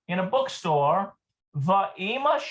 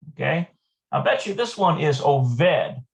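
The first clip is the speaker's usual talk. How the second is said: normal talking voice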